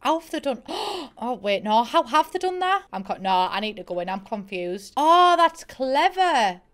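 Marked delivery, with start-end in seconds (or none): 0.7-1.1 sharp, high gasp of surprise; startled gasp. Real, not acted. Reviewer puzzling over the windows, sudden amazed gasp. Startled by how build was done. 5.0-6.6 loud, high, drawn-out admiration; impressed realisation. Real, not acted. Reviewer spotting the window trick, loud delighted realisation.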